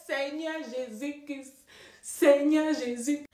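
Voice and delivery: high, slow thanks to the Lord; drawn-out thankful cry; grateful exclamation. Retold or imitated. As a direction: praise the Lord, overjoyed. Thankful outburst to God.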